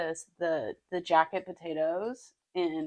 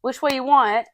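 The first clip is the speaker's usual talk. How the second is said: high, louder British street-vendor call; second go at a hammy British accent; bad British accent; 5 semitones higher, 6 dB louder than usual. Food-cart seller taking an order, bad British accent. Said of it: "Okay, second take".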